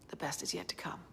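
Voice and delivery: soft unvoiced whisper of hope. Real, not acted. Signing off to a grieving family, whispered. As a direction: breathe it out as a secret promise.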